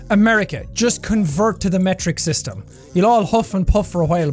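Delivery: usual speaking voice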